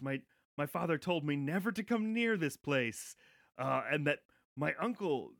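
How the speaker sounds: high, hammed-up startled panic; startled Richard. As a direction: play Richard spooked and babbling. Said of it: "looks around very startled".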